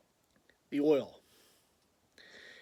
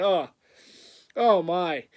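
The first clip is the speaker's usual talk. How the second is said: normal talking voice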